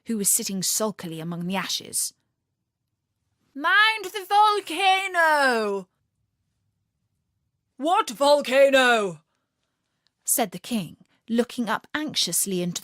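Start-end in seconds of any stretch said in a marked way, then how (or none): 3.5-5.9 high, slow, loud warning call. A narrator's reading. 7.8-9.2 loud, slow, puzzled king. A narrator's reading. King answers anxiously.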